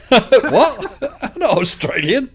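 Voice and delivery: high, loud incredulous laughter-filled protest; laughing disbelief. Retold or imitated. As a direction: protest through laughter, pitched right up. Laughs at being taken for Australian.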